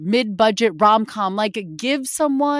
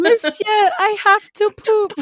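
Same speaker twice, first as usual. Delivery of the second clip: put-on high, slow, hammy French accent; 11 semitones higher, 4 dB louder than usual. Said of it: "Best French accent".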